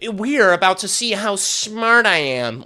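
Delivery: a lighter version of the smug expert voice; mocking smug poster. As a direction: parody the self-satisfied fan. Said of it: "come out and are just like".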